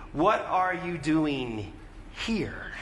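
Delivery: slow phrase, pause, then HERE set apart; stress on HERE. Shown as a demonstration. Preacher trying a third emphasis on God's question, stress on "here". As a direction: hold back, then drop "here" on its own. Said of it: "Where's the emphasis in that question?".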